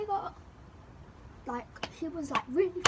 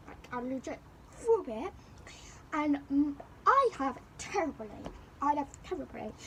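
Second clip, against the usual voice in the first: normal talking voice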